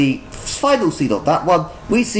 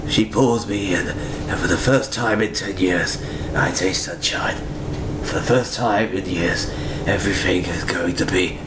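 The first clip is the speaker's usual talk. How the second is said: noir narration; 11 semitones lower, 4 dB quieter than usual. A narrator's reading. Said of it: "then we get more narration".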